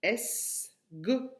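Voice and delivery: "esgo" slow, as two separate syllables. Shown as a demonstration. Teacher giving what remains, "esgo" split by syllable. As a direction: leave a gap between es and go in "esgo". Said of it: "J'enlève la deuxième syllabe car".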